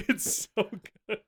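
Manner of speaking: ordinary delivery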